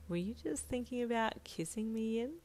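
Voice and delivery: soft, low and teasing; low provocative. A narrator's reading. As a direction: lean in and tease quietly. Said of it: "in a low, provocative tone".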